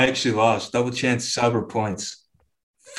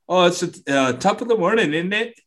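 bad imitation of an English accent, higher pitched; mock British accent; 6 semitones higher than usual. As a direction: ham up a fake English voice, lightly. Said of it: "What the fuck was that?".

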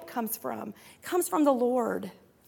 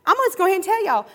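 ordinary delivery

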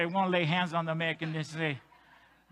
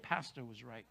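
much lower and softer, reluctant; a grudging, quiet concession; grudging mutter; 10 semitones lower, 15 dB quieter than usual. Pretend. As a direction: admit it under your breath. Grudging admission voiced for listener.

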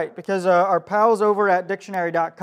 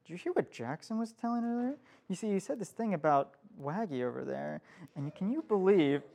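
soft, hushed gossip with a giggle; mock gossiping hush; 9 dB quieter than usual. Pastor acting the gossip whispering to a friend, hushed and giggly. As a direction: lean in and dish quietly, chuckling. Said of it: "I'd be like".

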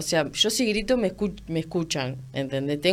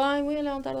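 half-hearted self-mimicry; 8 semitones higher, 3 dB quieter than usual. Mimics herself lifting without focus.

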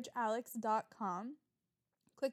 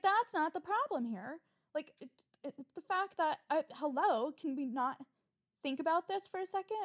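exasperated retort; 4 semitones higher than usual. Retold or imitated. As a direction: push back, fed up and incredulous. Retold argument with car dealer.

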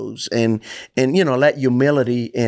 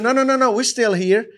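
higher-pitched, urgent reassurance; urgent calling out; 9 semitones higher than usual. Acted. Paul to the jailer about to act, urgent calling out. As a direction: call out quickly to stop him.

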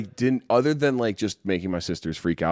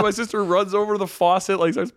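normal talking voice

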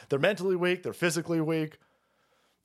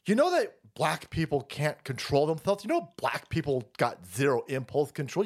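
mocking liberal ladies; 2 semitones lower than usual. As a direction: sneer it out like a clueless progressive. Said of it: "rancid public school drag weirdos said".

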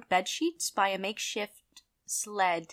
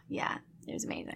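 breathy whisper of delight; 9 dB quieter, faster than usual. Real, not acted. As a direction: whisper it dreamily. Savoring memory of food.

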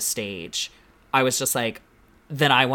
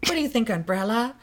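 much higher ditzy question; silly high umbrella voice; 10 semitones higher, faster than usual. Retold or imitated. Cohost echoing the queen talking to umbrella, high ditzy voice. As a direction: coo at the umbrella like it answers. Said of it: "talking to the umbrella".

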